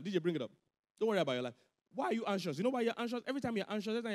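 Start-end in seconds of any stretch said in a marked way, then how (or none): none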